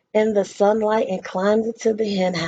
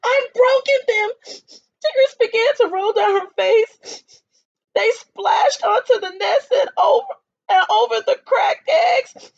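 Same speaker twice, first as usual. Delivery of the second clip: higher, louder crying voice; tearful; 10 semitones higher, 4 dB louder than usual. A narrator's reading. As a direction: sob through the words. Hen crying over eggs.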